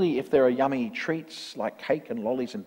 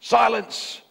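high, sharp kingly bark; barked command; 10 semitones higher, 5 dB louder than usual. A narrator's reading. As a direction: bark it as an order from the throne. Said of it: "the king of the turtles barked back".

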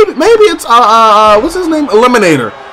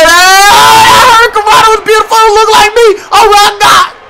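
high, loud cheering; excited shouting; 11 semitones higher, 3 dB louder than usual. Real, not acted. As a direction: cheer like a hyped fan. Cheering the hurricanrana.

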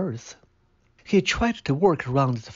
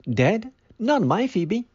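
shocked disbelief; 9 semitones higher, 4 dB louder than usual. Acted. Old man denies wife's death.

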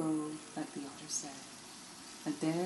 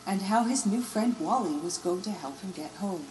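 ordinary delivery

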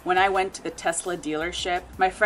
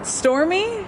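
surprised; 11 semitones higher than usual. Real, not acted. Disbelief at car name.

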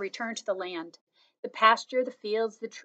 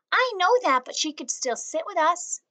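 put-on high, louder girl insisting; a child pushing back on her brother; little girl protest; 8 semitones higher, 4 dB louder than usual. A narrator's reading. Jaya answers brother.

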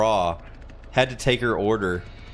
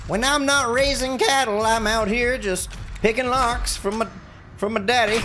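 put-on horrible Southern accent; 12 semitones higher than usual. Player voicing her character Connie in a bad Southern accent. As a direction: ham up a cattle-ranch Southern girl. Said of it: "That was a horrible accent".

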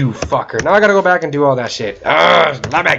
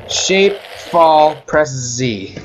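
ordinary talk, nothing marked

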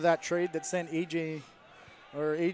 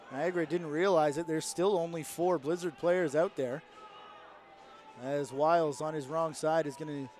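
usual speaking voice

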